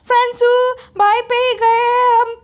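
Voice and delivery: high, slow teasing voice; high smug mimicry; mocking brother-in-law. Mocking a family member's retort.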